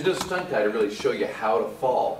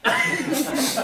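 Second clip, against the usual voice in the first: high burst of laughter. Real, not acted. Laughing at the failed shot.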